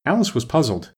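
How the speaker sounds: ordinary delivery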